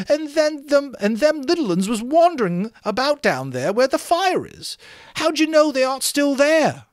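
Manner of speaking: put-on high, far-off fretful boy's voice, well above the narrator; Piggy's whiny voice. Piggy scolding the boys for rushing off, high indignant boy voice. Piggy warns about the little ones.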